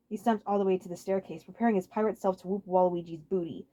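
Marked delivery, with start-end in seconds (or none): none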